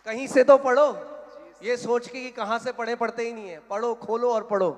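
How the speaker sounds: loud, high-pitched preaching; exasperated exhortation. Real, not acted. Pastor pushing congregation to open the Bible, exasperated shouting. Pastor urges people to just read.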